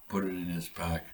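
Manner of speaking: plain reading voice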